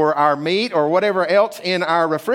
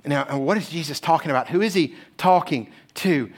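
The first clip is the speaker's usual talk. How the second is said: ordinary delivery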